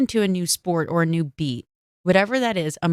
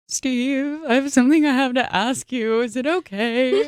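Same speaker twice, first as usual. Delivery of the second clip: nervous ask; 7 semitones higher than usual. Retold or imitated. Asking boss nervously, retold.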